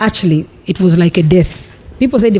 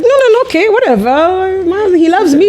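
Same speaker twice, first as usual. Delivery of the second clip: naive denial; 11 semitones higher than usual. Retold or imitated.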